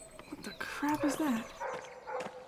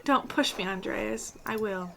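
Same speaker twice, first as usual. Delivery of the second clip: ordinary talk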